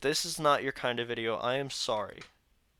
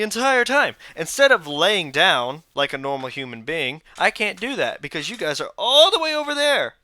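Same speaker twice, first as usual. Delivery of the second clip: loud, high, exasperated venting; fed-up rant about a sore back; frustrated rant; 6 semitones higher, 10 dB louder than usual. Real, not acted. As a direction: let the annoyance boil over. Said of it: "I'm frustrated".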